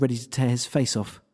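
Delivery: usual speaking voice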